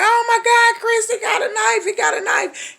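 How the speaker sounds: high, frantic screaming for help; panicked holler. Retold or imitated. Woman hollering to Chris in terror about the knife. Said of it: "I'm hollering like".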